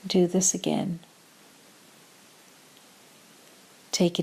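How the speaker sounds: calm meditation voice with long pauses; short, measured phrases, leaving room to breathe; guided meditation. Acted. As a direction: guide them gently into stillness, unhurried.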